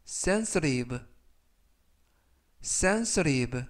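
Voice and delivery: sensitive with the t softened to an r, sen-se-riv; soft flapped t. Shown as a demonstration. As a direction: flap the t in sensitive like a quick r. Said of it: "부드럽게 트가 아니라 르". (sensitive의 t를 트가 아니라 르처럼 부드럽게)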